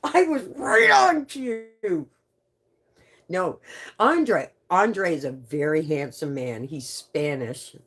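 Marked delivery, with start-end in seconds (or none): 0.0-2.1 hammed-up diva outrage, slowed; mock-offended diva scolding coworkers; mock indignation. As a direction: act offended at the interruption. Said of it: "So I'm like".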